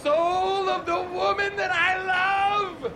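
high, anguished distant wail; Sweet Prince Vantis. Acted. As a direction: cry it out like a crazed romantic hero. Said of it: "This unhinged voice".

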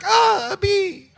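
put-on high shrieking scared child. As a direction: squeal it like a frightened little kid. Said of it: "impressions of ... a child".

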